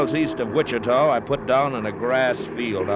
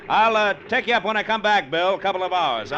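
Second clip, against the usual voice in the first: pitched up, calling over noise; raised over engine; 9 semitones higher, 3 dB louder than usual. Acted. Pilot answering Bill over the motor, raised voice. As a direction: lift it over the propeller roar.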